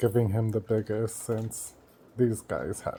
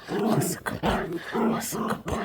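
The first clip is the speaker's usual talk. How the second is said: high, soft doting coo; soft pet voice, higher; 7 semitones higher, 4 dB quieter than usual. Real, not acted. Cooing at dog.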